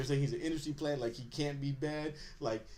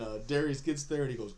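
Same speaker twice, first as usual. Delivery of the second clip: ordinary talk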